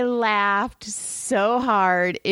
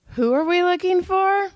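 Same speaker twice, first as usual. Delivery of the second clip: light mock innocent question, higher; drunk man; 9 semitones higher than usual. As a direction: ask it cluelessly. Drunk man asking search party.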